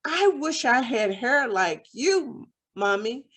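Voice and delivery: ordinary delivery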